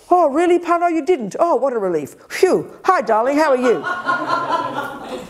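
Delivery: high, gushing relief, then a drawn-out slow greeting with a laugh; mock relieved sweetness. Jealous wife greeting husband after his denial, gushing mock relief. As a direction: ham up the sugary relieved partner. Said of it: "you'd think I'd go".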